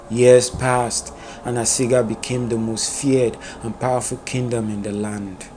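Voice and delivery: plain reading voice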